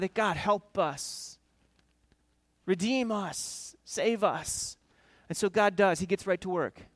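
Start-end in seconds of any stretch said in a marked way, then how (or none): 0.1-4.8 pleading cry. Retold or imitated. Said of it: "And they cry out for God".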